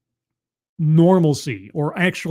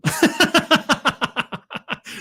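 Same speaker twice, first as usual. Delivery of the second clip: high unvoiced laugh; 11 semitones higher than usual. Real, not acted. Laughs at the irony.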